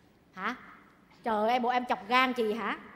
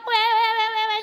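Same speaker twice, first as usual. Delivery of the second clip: high-pitched wah-wah imitation; mock the infantile bawling, high up; mimicking baby cry; 9 semitones higher, 4 dB louder than usual. Mimics a baby's crying.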